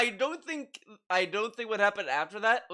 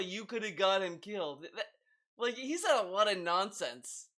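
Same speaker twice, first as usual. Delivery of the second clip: normal talking voice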